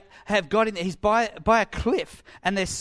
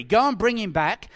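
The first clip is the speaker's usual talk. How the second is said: acted angry command, louder and higher; angry order; 5 semitones higher, 6 dB louder than usual. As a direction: send the guards off angrily. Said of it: "They're angry".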